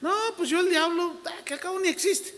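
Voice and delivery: high, mocking voice of a careless believer; a high, dismissive caricature of a naive believer. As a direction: mimic a naive churchgoer shrugging off the devil. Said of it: "cristianos inocentes que dicen".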